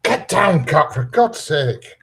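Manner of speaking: high loud yell, then slow; real exasperated shout at the pet; shouting at an animal. Real, not acted. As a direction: bark the command, then drag it out in exasperation. Yelling at an animal.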